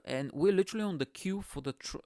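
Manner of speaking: ordinary delivery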